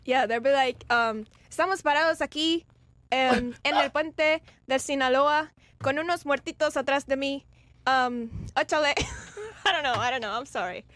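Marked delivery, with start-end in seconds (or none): 1.5-8.9 Mexican Spanish reporter voice, lightly done; a hint of a Mexican TV reporter; Mexican accent. Imitating a Mexican reporter for the stream's chat, in a Mexican accent. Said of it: "You gotta do it with a, his Mexican accent".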